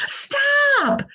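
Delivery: high storybook shout at the crow. A narrator's reading. Frog Jerome shouting at the giant crow, high shout. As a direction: yell it up high to scare a bird.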